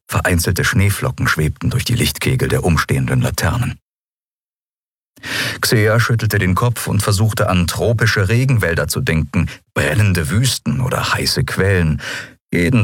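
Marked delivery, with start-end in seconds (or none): none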